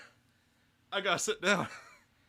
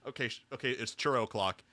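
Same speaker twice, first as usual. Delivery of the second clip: ordinary delivery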